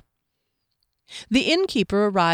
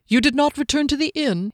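higher, pointed question; rhetorical; 8 semitones higher than usual. A narrator's reading. Captain questioning Kier about the inn, rhetorically. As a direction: ask it as if already knowing. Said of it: "he asked rhetorically".

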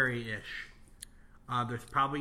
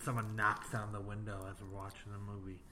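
whispering; 5 semitones lower, 8 dB quieter than usual. Acted. Spooking friend before horror movie.

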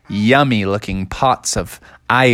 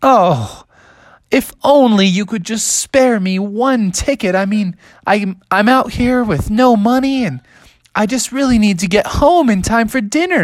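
put-on high, pleading young bear; pleading Bubby; 8 semitones higher than usual. Bubby begs the airport clerk for one ticket, high and pleading. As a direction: beg sweetly in a high voice. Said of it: "And Bubby was like".

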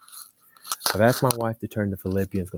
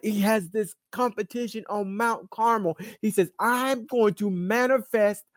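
rising, driving preacher's delivery; building preaching; 7 semitones higher, 5 dB louder than usual. Real, not acted. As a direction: pitch climbing into pulpit fervor.

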